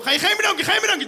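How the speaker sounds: high, loud ranting at the driver; hammed-up angry yelling; mock road rage. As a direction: berate the rude driver.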